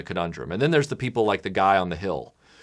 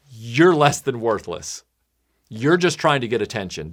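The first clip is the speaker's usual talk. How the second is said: ordinary talk, nothing marked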